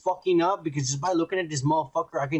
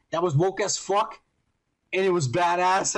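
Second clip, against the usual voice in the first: ordinary talk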